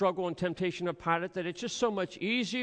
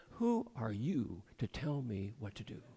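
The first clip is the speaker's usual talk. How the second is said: muttered indignation; 6 semitones lower, 8 dB quieter than usual. Retold or imitated. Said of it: "hear yourself saying".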